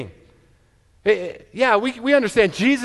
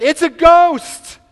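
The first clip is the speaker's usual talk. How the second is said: high, loud, slow shriek of alarm; terrified cry; 10 semitones higher, 9 dB louder, much slower than usual. Retold or imitated. Disciples seeing a figure on the sea, terrified shriek. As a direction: cry out like a frightened sailor. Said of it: "they were terrified".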